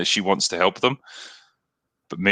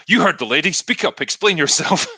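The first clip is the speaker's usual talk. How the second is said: higher-pitched imitation of a reprimand; put-on indignant demand; mock scolding; 8 semitones higher than usual. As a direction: playfully order someone to explain. Kirk feigns innocence, scolding Spock.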